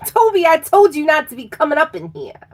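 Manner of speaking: fast, loud, high mock telling-off; mock scolding. Reader catches Toby back in the reading, mock scolding. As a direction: scold the intruder in playful outrage.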